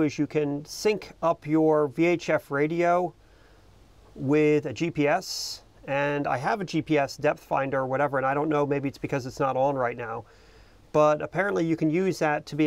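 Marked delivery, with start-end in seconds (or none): none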